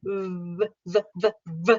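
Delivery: V, v, v, v buzzed with teeth on lip; repeated v sounds, lip bitten; buzzing V drill. Shown as a demonstration. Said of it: "bite your lip".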